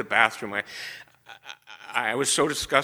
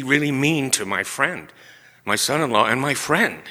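ordinary talk

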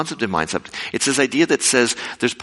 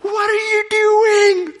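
much higher and louder, stretched slowly; alarmed computer shout; 4 dB louder, much slower than usual. Retold or imitated. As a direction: shout it out like a dive computer going haywire. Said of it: "Our computers were freaking out".

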